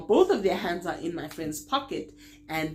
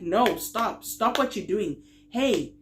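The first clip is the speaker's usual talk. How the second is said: retold protest; 4 semitones higher, 5 dB louder, slower than usual. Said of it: "I started telling them again".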